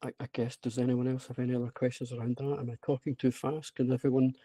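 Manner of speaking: normal talking voice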